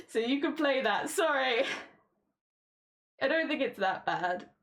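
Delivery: usual speaking voice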